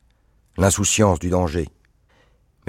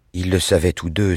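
ordinary delivery, nothing marked

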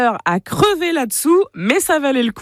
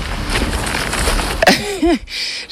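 breathy burst of laughter; amused laugh; 8 semitones higher than usual. Real, not acted. Reporter's first reaction at the frog pond, amused laughing. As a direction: laugh at what has just been spotted. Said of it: "Je, je rigole".

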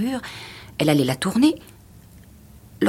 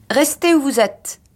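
high, loud command; firm order; 10 semitones higher, 5 dB louder than usual. A narrator's reading. Husband ordering his wife to stay put, firm command. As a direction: order it with no room for argument. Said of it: "ordonna-t-il".

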